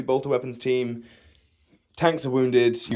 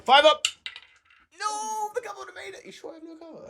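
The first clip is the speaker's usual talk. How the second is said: high disbelief. Real, not acted. Disbelief at the cover rule.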